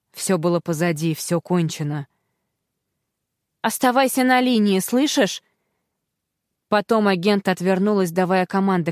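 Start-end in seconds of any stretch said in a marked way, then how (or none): 3.6-5.4 raised, urgent call; urgent plea. Acted. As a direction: keep her on the line. Agent urging on phone.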